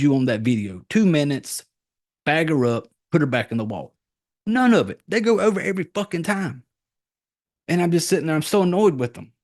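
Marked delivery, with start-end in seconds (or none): 4.5-6.6 exasperated. Real, not acted. Ranting to viewers about the movie's broken rules, exasperated. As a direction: vent it, really annoyed. Annoyed rant about rule-breaking.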